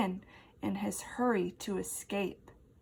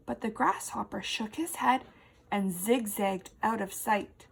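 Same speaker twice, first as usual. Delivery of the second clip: ordinary delivery, nothing marked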